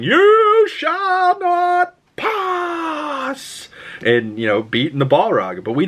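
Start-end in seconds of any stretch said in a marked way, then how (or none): 0.0-3.7 very high, drawn-out booming wizard cry; Gandalf impression. Vlogger imitating Gandalf facing the Balrog, long booming wizard cry. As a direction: stretch every word like a wizard blocking a bridge. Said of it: "him with the staff going".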